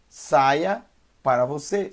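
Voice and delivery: ordinary delivery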